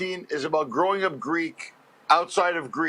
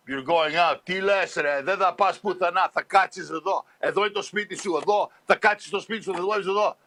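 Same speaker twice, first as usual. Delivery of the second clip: scolding dad in Greek. Retold or imitated. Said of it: "Greeks would always scold you in Greek".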